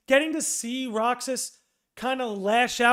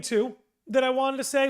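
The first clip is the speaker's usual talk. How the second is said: ordinary talk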